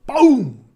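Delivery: a big loud high "boom" for the crash; a loud boom as the stone hits Hector; loud mock boom. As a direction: slam the sound effect out. Teacher voices a stone crashing down.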